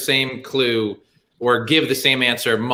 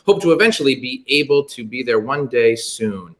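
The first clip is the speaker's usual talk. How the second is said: ordinary talk, nothing marked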